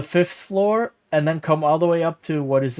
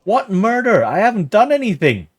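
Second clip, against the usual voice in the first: higher and louder, protesting innocence; indignant outburst; 6 semitones higher, 5 dB louder than usual. Real, not acted. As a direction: snap back, stunned and offended. Protests false murder accusation.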